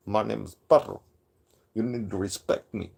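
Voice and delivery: put-on accented voice, easing down to a demand; Padro. Streamer mimicking his rival demanding respect, low mocking impression. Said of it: "Did I pull that off pretty good?".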